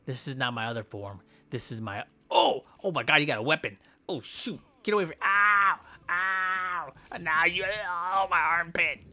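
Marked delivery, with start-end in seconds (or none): 2.3-9.0 pained yelp. Acted. Getting stabbed in game.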